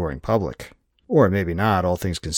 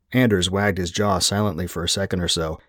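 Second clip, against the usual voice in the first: usual speaking voice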